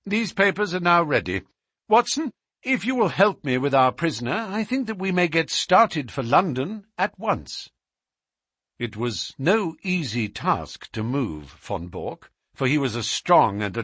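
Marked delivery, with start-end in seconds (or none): 0.1-7.7 lightly voiced detective, taking charge; a hint of a higher-pitched sleuth organising departure; Holmes. A narrator's reading. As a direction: lightly play him businesslike. Detective gives brisk orders.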